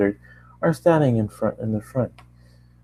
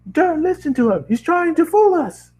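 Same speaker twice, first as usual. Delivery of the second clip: high panicked yell. Acted. Scientist yells to crowd.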